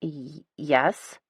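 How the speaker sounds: nervous stutter on yes; flustered, tripping over yes. A narrator's reading. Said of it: "she stutters".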